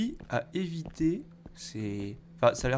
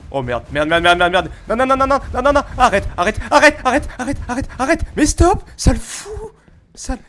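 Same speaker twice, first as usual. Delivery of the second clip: loud high frantic pleading; panicked yelling; 10 semitones higher, 11 dB louder than usual. Real, not acted. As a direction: shout in real panic while fleeing. Monster chasing, frantic pleading. (supplications paniquées)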